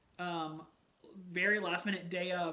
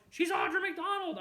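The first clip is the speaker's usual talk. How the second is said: starstruck exclamation; 11 semitones higher, 6 dB louder than usual. Real, not acted. Fan gushing over a favorite actress from Annie, excited. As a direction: gush it out loud and high.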